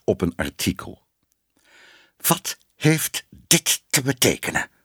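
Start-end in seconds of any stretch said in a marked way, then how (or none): 2.2-4.7 slow, high-pitched indignant demand; trembling with rage. A narrator's reading. Ellemeet confronting the editor over an article, enraged. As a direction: demand an answer, word by word, in fury. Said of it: "bleek van woede en zijn stem trilde".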